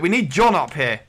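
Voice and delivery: ordinary talk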